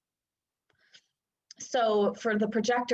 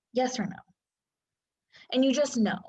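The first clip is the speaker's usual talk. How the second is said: ordinary talk, nothing marked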